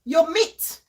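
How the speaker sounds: lightly voiced Oyibo, mock incredulous; puzzled foreigner. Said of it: "Oyibo is thinking".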